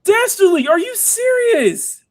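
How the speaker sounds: very high incredulous squeal; stunned disbelief. Real, not acted. Streamer to surprise tipper, incredulous squeal. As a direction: squeal at them, stretching the question.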